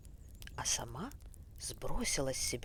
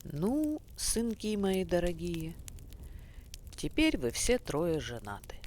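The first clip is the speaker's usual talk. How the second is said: higher, louder voice of a commanding tsar; a king addressing his sons, raised and loud; old tsar; 4 semitones higher, 4 dB louder than usual. A narrator's reading. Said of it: "и говорит".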